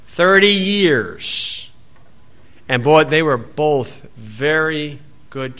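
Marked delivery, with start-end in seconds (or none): 0.1-1.7 drawn-out, weighty "Thirty years"; slow emphatic repeat. Acted. Preacher repeating the man's answer, slow and weighty. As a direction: let "Thirty years" sink in slowly.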